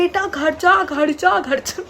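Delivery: hammed-up shout of an uncle telling a kid to run home; imitated shout from afar. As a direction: uncle shouting from inside his shop for the girl to go home, mock yell. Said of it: "चिल्ला के बोल रहे".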